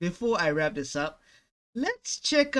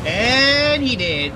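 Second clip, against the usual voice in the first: dramatic punchline; 7 semitones higher, 10 dB louder, much slower than usual. Real, not acted. Punchline after victim's fate.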